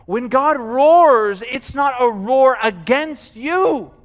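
loud, high, slow emphatic proclamation; forceful preaching. Real, not acted. Preacher tells congregation God roars against sin, forcefully. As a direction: preach it out, driving home each phrase. Sermon climax on God's roar.

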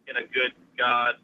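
normal talking voice

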